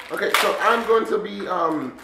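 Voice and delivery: ordinary delivery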